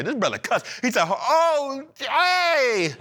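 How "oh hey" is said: mock cussing. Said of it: "he cussed right here".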